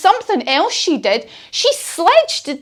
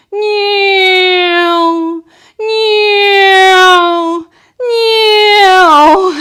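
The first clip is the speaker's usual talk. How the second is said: long, higher, louder cat meows; drawn-out meows; 7 semitones higher, 6 dB louder, much slower than usual. Shown as a demonstration. Aeroplane pose turned into silly long meows. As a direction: stretch each meow like a cat. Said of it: "Are you ready".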